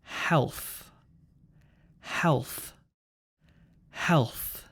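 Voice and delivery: "Health" ending in a burst of breathy th; explosive final th. Shown as a demonstration. Teacher models words for repeating, explosive th ending "Health". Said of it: "explosive th sound at the end".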